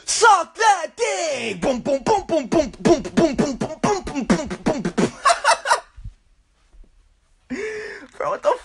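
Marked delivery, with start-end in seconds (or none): 0.1-1.6 high, drawn-out hype shout; mock techno shout. Techno beat drops, high mock vocal shout. 1.6-5.1 high, pumping mouth-made kick drum; imitated techno beat. Techno drop after the build-up, imitated beat. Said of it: "it turns into a techno song".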